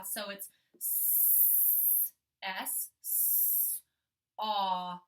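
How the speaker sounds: s- whispered, then aw stretched; sounding out. Shown as a demonstration. Teacher sounding out saw for kids, stretched sounds. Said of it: "help Miss House sound out the word saw".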